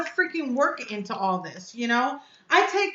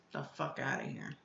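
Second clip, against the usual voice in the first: muttered dismissal; 18 dB quieter than usual. Real, not acted. Disgusted dismissal of his message.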